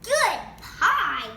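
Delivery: high, loud call. Acted. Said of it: "calls Bean".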